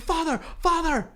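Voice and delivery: high-pitched mock cry, held back in volume; imitated screaming. Retelling woman screaming for priest, high mock scream. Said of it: "she was screaming".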